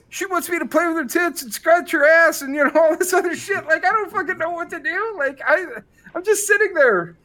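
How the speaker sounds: high-pitched, rambling bewilderment; acted-out helpless exasperation; exasperated complaint. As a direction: whine the complaint to a buddy, pitch way up. Acts out exasperated complaint to buddy.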